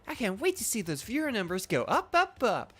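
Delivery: high-pitched, showy character voice; Iono. Acted. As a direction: play her like a theatrical show host. Voicing Iono's game lines.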